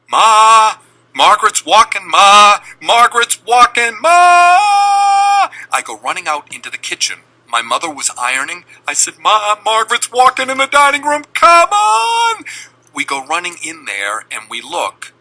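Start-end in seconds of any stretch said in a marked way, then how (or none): 0.1-5.5 high, loud, breathless calls for Ma; excited kid shouting. Retold or imitated. Little brother calling his mother from the dining room, loud and high. 9.2-12.4 high, loud, breathless calls for Ma; excited kid shouting. Retold or imitated. Little brother calling his mother from the dining room, loud and high. Boy runs to ironing mother.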